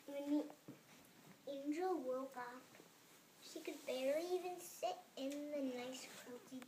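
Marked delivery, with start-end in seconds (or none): none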